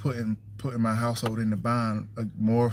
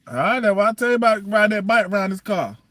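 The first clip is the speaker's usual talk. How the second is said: calling out; 9 semitones higher, 7 dB louder than usual. Real, not acted. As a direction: raise your voice to reach him. Calling out to child on bike.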